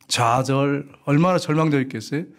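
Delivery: normal talking voice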